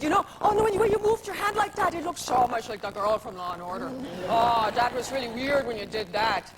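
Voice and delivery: a hint of an Irish fan getting worked up; lightly voiced Irish drunk, excited; Irish accent. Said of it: "your little Irish".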